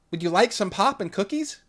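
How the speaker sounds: high, loud hostess voice; a too-cheery mom offering snacks; sugary mother voice; Lauren's mother. A narrator's reading. Artificial mother asks cheerfully.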